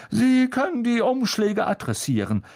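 high, grand, self-satisfied voice; Lockhart. Acted. Lockhart ordering Harry to address envelopes, high and pompous. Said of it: "wies Lockhart Harry an".

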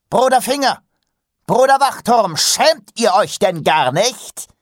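furious, high and loud scolding; booming male voice. A narrator's reading. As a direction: roar the names, then drag out the reproach. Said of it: "grollte er".

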